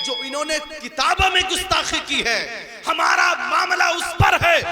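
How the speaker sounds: shouted, high preacher's voice; full-force sermon shout; fiery preaching. Real, not acted. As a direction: thunder it at the congregation.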